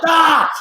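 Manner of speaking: high mock scream of frustration; frustrated yell. Narrator interrupted by room service, frustrated yell. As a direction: burst out in a retold exasperated yell.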